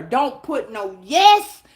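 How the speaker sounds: ordinary delivery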